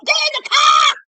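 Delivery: high, loud shout; pretend yell. Yelling at her friend to get in the car, loud shout. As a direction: bark the order to get in.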